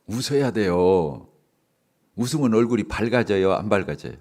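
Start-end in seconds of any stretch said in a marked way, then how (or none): 0.1-1.3 slow smiling line. Shown as a demonstration. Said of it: "저처럼 이렇게 웃으라 말이에요".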